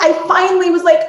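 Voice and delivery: normal talking voice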